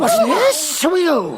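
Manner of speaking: high, loud sudden questions; startled demand. Acted. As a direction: demand who dares interrupt. Foma startled by the nephew.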